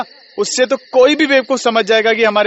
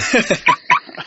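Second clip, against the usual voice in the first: short amused laugh; 3 semitones higher than usual. Acted. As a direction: laugh off the teasing. Friend laughs off the teasing.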